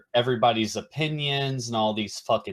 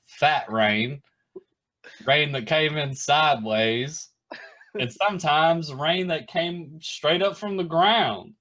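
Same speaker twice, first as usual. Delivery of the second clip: Forrest Gump impression; 5 semitones higher than usual. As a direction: recite the rain list like Forrest Gump. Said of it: "that's like Forrest Gump".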